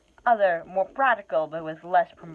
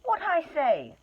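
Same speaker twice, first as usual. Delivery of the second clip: gasping hobbit; 13 semitones higher, slower than usual. Acted. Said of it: "said Bilbo, gasping".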